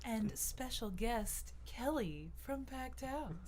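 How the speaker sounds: slow, smooth announcer voice; drawn-out radio host delivery. Pretend. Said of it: "I put on my radio voice for you guys".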